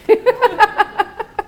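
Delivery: real laughter at the memory; high laugh. Real, not acted. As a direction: laugh out at the punchline. Laughing at own story.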